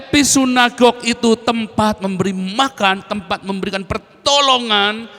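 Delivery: high, loud, slow proclamation; full-force preaching. Real, not acted. Preacher proclaims God's word to congregation, full-force preaching. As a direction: ring out God's word to the room.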